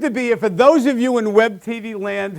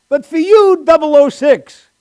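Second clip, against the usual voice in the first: loud, high Scottish Bond drawl; loud, high mock Scottish; mock Sean Connery; 9 semitones higher, 11 dB louder than usual.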